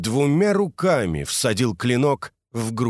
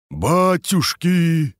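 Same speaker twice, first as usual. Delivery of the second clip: upset; 7 semitones higher, 4 dB louder, much slower than usual. Acted. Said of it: "бормотал подрядчик в расстройстве".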